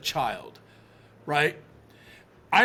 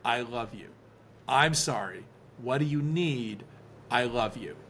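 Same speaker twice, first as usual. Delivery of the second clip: soothing repetition; 4 semitones lower, 3 dB quieter than usual. Retold or imitated. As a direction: repeat it gently, each phrase settling lower. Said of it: "repeating to her over and over again".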